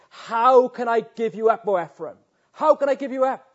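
high, loud, crying out in anguish; anguished divine plea. Acted. God pleading over unfaithful Ephraim, high anguished cry.

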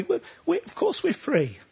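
high spluttering "We, we," then insistence; mock indignant crowd; retold listeners bristling at Jesus; defensive protest. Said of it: "they start disputing with him".

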